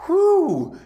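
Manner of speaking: high, loud whoop of relief; relieved whew. Acted. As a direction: let out a big high whew. Freed debtor's relief.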